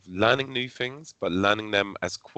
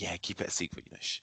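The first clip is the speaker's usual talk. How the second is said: much softer, hushing; hushed secretive; 10 dB quieter than usual. Retold or imitated. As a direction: keep it quiet, shh it. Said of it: "I was like".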